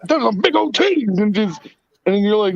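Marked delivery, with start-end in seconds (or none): 0.1-1.2 higher, sudden blurted tic voice; imitating a tic blurt. Retelling a girl's Tourette's tic, higher mock blurt.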